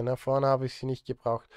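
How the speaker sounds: ordinary talk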